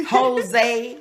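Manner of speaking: Jose with an h start and an ay ending; alternative pronunciation. Shown as a demonstration. Guest listing pronunciations for the host, Jose as ho-ZAY. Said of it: "exactly how to pronounce".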